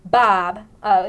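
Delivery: loud call of a name; mock alarmed call of Bob; retold call. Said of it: "Julia said".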